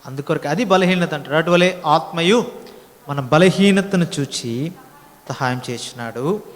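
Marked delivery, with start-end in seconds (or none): 1.3-6.4 "అటువలే ఆత్మయు" drawn out slowly, word by word; slow scripture reading. Shown as a demonstration. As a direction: read the verse slowly and deliberately, letting each word land. Reads the verse out solemnly. (నెమ్మదిగా వచనం చదవడం)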